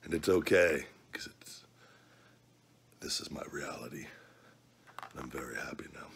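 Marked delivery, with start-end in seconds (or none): 1.1-6.1 sad little whisper to himself. Real, not acted. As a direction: breathe it out, barely voiced. Whispered aside about his reality.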